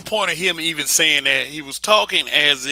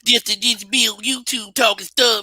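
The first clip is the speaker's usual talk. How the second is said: high-pitched mock hater voice; 8 semitones higher than usual. Imagined hater griping about YouTubers, high mocking voice. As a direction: put on a complaining hater, pitched way up. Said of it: "Some hater out there probably watching".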